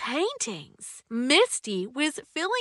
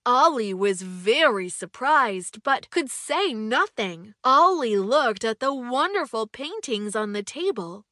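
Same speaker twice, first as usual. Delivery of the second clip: ordinary delivery, nothing marked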